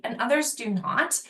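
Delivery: ordinary talk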